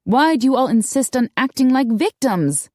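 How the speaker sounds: pointed, frustrated question; exasperated. A narrator's reading. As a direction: demand it, out of patience. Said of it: "Darcy had had enough".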